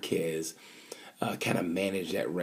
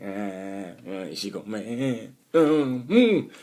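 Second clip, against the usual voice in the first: nervous parent; much slower than usual. Pretend. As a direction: hold your breath, then flinch. Nervous parent at ice rink.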